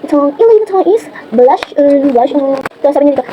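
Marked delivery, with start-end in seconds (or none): none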